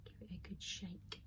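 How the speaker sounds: soft unvoiced whisper. Real, not acted. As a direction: breathe it out under your breath. Whispering while mixing resin.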